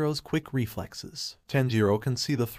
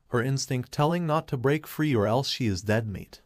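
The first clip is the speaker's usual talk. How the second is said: usual speaking voice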